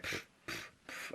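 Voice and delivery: short voiceless puffs of air; imitated paper-tossing noise. Imitating the teacher throwing papers at us, dismissive puffs. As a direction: puff out a toss sound three times. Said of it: "just kinda throwing them at us".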